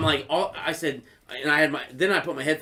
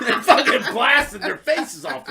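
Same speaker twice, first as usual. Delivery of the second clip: ordinary talk, nothing marked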